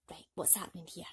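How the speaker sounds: hushed, conspiratorial peek at the page; whispered aside. Real, not acted. Storyteller leans in conspiratorially.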